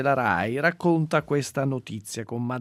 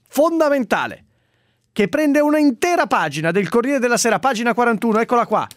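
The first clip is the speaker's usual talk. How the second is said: town crier; 8 semitones higher than usual. Acted. Proclaiming announcement, udite udite.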